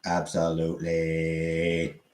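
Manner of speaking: slow, flat robot voice; monotone robot. Shown as a demonstration. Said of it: "like a robot".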